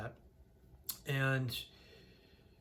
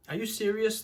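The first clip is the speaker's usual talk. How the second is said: higher, louder retold outburst; livid; 7 semitones higher, 5 dB louder than usual. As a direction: fire it back in angry disbelief. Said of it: "I was livid".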